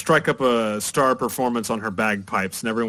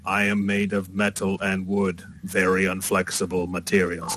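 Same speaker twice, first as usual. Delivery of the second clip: lower, stiff machine-person voice; stiff warforged; 3 semitones lower than usual. Acted. Warforged correcting the girl about being unflexible, stiff and low. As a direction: answer literally, stiff and low. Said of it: "all stiff and".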